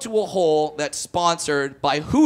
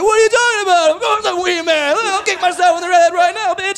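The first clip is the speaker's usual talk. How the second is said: much higher, louder, laughing outrage; mock indignant shout; 12 semitones higher, 5 dB louder than usual. Comedian loudly insisting he is Wee Man. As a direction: protest like you're insulted. Indignantly defending fake identity.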